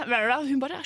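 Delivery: ordinary delivery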